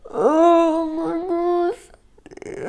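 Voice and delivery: "Oh my gosh" muffled behind a hand; hand over mouth. Real, not acted. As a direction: gasp "Oh my gosh" through covered mouth. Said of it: "I put my hand over my mouth".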